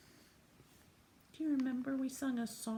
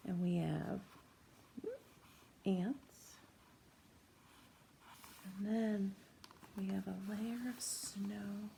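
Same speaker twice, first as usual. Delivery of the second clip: normal talking voice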